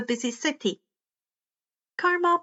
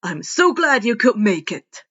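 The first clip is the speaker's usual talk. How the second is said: louder, beaming and big-voiced; hearty All Might; 5 dB louder than usual. All Might greeting Izuku, louder and hearty. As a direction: welcome him like a proud hero.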